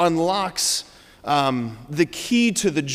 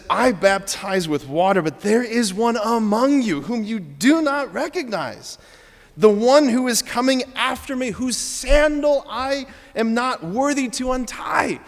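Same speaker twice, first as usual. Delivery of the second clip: joy radiating, rising higher, slowing into the last words; joyful John; 5 semitones higher than usual. Shown as a demonstration. Preacher voicing John to his parish, now with radiant joy. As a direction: let the joy of knowing burst through. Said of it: "there is joy radiating out of John".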